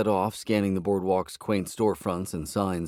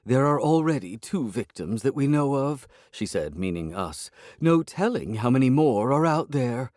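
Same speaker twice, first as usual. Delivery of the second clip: higher, louder mock gravity; dramatic cat; 6 semitones higher, 4 dB louder than usual. A narrator's reading. Cat claims victimhood, higher and dramatic. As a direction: play the victim grandly.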